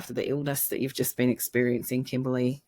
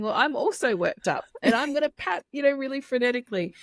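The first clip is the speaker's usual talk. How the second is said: worked-up parent; 9 semitones higher than usual. Pretend. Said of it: "you're saying".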